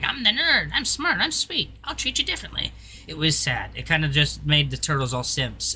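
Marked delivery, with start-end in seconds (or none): 0.0-2.7 high squeaky nerd voice; nerdy high voice. Retold or imitated. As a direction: pitch way up, a sweet-talking nerd. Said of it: "Donnie was all like".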